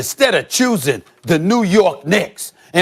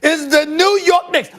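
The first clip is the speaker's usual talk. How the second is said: much higher, slow, sing-song proclaiming; proud shout; 11 semitones higher, slower than usual. Acted. A diehard fan proclaiming his team's defense in a high, drawn-out shout. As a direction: trumpet it like a victory chant.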